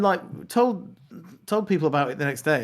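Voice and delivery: normal talking voice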